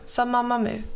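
usual speaking voice

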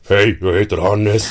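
mock booming handshake hello; booming greeting. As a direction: boom out the introduction like a big Icelander. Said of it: "Hans röst blev tio gånger starkare".

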